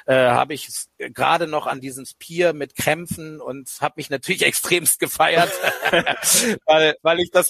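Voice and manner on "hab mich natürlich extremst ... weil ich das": laughing through the words. Real, not acted. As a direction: chuckle while saying it. Laughs at own triumph.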